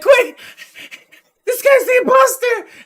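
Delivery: very high, loud cry of alarm; mock panicked kid. Kid running to Sam for help, mock-panicked high shout. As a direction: raise the alarm in a high squeal.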